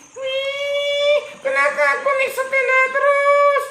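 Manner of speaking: high, exclaimed grumble; sad complaint. Acted. Cyclist complains he only rides a bike, high grumble. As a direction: cry out over the bicycle. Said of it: "Dia sedih".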